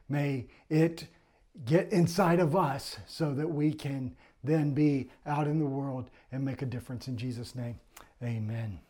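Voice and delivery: hushed pastoral prayer, easing down to the amen; congregational prayer. Acted. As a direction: pray gently and earnestly, letting phrases settle.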